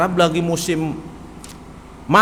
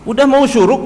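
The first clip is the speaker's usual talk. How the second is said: urgent; 7 semitones higher, 5 dB louder than usual. Acted. Urgent dawn before prayer.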